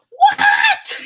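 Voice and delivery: very high, loud squeal of disbelief; shocked shriek. Retold or imitated. Hearing mom's news, shocked shriek. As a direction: shriek it, far above normal pitch.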